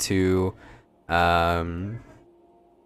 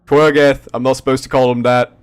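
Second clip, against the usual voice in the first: high, loud goofy guard; dopey guard voice; 5 semitones higher, 7 dB louder than usual. Acted. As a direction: blurt it out like a clumsy guard.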